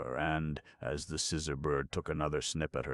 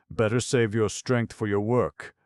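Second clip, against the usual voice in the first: advising; 8 semitones higher, 9 dB louder than usual. A narrator's reading. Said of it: "advised Peacer".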